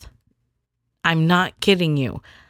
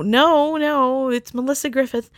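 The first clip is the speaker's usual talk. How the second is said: high, firm correction of her name; high correcting no; 8 semitones higher than usual. Retold or imitated. Correcting people who mispronounce, high and firm. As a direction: set them straight, pitched up. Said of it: "I would be like".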